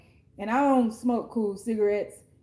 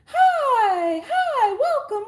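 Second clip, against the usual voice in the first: much higher, bright fake-cheery welcome with a laugh; high mocking hello; mock sweet greeter voice; 11 semitones higher, 4 dB louder, much slower than usual. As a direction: parody the sugary tarot reader greeting. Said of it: "I'm not coming in here speaking in a different voice".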